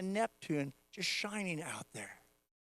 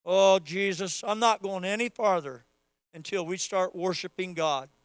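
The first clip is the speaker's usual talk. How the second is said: normal talking voice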